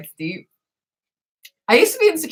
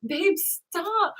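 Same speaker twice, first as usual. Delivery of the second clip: soft pleading; 9 semitones higher, 9 dB quieter, much slower than usual. Retold or imitated. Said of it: "and she's like".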